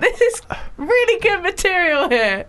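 high laughing glee; laughing delight. Real, not acted. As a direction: crack up while praising it.

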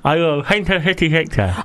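lips apart, p and f blurred; mouth held open. Real, not acted. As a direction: talk through a mouth guard. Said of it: "the mouth is wide open".

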